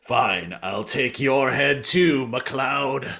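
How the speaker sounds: slow, drawn-out mock villain threat; rival Viking warrior. Rival Viking threatens the Scottish one, hammed-up menace. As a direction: draw out the threat theatrically. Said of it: "the other one is like".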